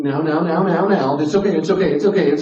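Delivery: high, loud, repetitive fussing; hammed-up panicky reassurance; frantic mock soothing. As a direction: rush to shush someone's crying, overdone and loud. Mock-hushing someone who starts crying.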